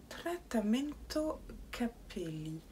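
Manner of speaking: put-on a light Italian accent on Trattamento Capelli, slow; accent italien léger et appuyé; mock Italian accent. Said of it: "me reprendre ... sur mon accent".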